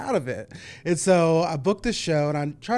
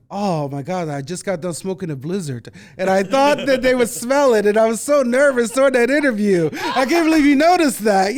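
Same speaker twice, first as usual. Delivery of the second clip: excited confession; 7 semitones higher, 10 dB louder than usual. Retold or imitated. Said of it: "And she's like".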